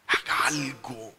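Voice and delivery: breathy whispered plea, slow and near tears; a whispered sob of a plea; tearful whisper. Real, not acted. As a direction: plead it in a broken whisper, slowly. Preacher urging a broken heart.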